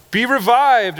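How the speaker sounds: high, loud, hammed-up decree; mock booming command. Preacher mocking how Jesus did not speak, in a hammed-up booming command. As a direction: blast it like a pompous prophet. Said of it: "He doesn't go".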